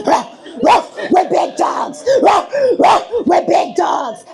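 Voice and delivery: hammed-up dog barks with chant; mock barking chant. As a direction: bark and chant like a hype man. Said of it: "And then I just started barking".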